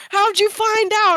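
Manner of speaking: high, loud mocking voice for the group; squeaky high curious question; teasing friends. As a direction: playfully imitate the pranksters. Friends asking at breakfast.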